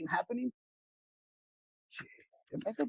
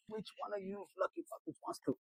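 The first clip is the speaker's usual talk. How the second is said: much higher, softer mock girly voice; girl at the bar; 12 semitones higher, 7 dB quieter than usual. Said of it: "if it's a girl walking in the bar".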